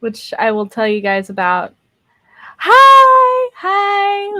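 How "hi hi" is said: high, loud squeal of hello; excited greeting. Real, not acted. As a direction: greet the chat with a burst of joy. Greeting chat viewers excitedly.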